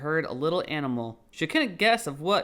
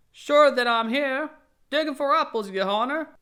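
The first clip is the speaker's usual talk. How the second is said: servant answering; 11 semitones higher, 7 dB louder than usual. Acted. Pat answers his master.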